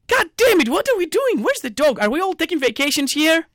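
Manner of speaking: high-pitched exasperated tirade; exasperated outburst. Acted. Fan scolding players who seem on holiday, exasperated. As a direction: fire off questions in a high, fed-up voice. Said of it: "But I'm like".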